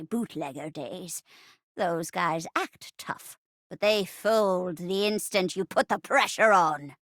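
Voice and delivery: put-on boastful old lady, dropping low; badass grandma. Granny boasts of her cursed sword, tough old-lady voice. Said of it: "I adore badass grandma characters".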